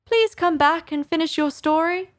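high call after someone leaving. Acted. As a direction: call out to the mouse walking away. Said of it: "Alice called after it".

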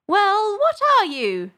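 high, put-on bird voice; challenging pigeon. A narrator's reading. Pigeon questioning Alice, challenging. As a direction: demand an answer, high and impatient.

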